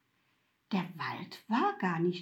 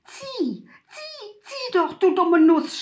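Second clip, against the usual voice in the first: shrill, much higher, loud scream; frantic scream; 9 semitones higher, 7 dB louder than usual. A narrator's reading. Mutwillen screaming at Laura to pull the alarm, frantic. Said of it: "schrie Mutwillen".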